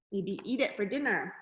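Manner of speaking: plain reading voice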